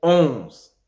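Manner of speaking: "onze" slow, nasal o then z, silent n; nasal vowel. Shown as a demonstration. Teacher giving nasal example, "onze" without sounding the n. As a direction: stretch the nasal start of "onze". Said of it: "il n'est pas prononcé".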